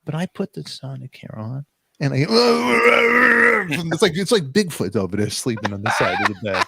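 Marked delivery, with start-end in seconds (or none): 2.2-3.9 loud, high outburst of laughter; loud burst of laughter. Real, not acted. Husband laughing at his snoring wife, loud laughter. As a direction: crack up loudly.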